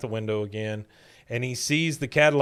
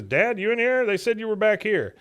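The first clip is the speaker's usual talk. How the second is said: calling out as Hank; 8 semitones higher than usual. Retold or imitated. Son calling for his dad.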